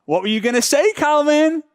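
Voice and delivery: high, plaintive calling after someone; mock needy pleading. Seller chasing silent Calvin, high and mock-desperate. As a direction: beg for a reply, pitch climbing on the name.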